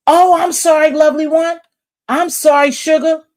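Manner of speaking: high, loud, syrupy fake-sweet voice; mock sweet sympathy. Mocking a streamer's sugary sympathy in a high, sweet voice. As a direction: coo the apology with mock sweetness.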